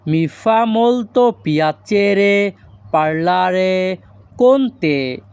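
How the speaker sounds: very slow, drawn-out syllables; slow Italian model. Shown as a demonstration. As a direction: model the sentence slowly for students. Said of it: "এই বাক্যের Italian ভাষা হবে". (ধীরে ধীরে Italian উচ্চারণ)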